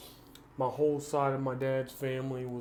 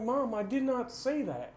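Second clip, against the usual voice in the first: indignant denial; 9 semitones higher than usual. Retold or imitated. Denying mom's false claim.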